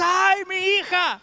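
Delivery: imitating a father's cry of grief, lightly; a hint of a grieving wail; mock wail. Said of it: "llegar a llorar a gritos".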